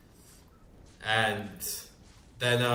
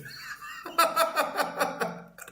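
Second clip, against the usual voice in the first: high, wheezing laugh; real laughter. Real, not acted. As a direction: crack up at your own story. Laughs at own retold insult.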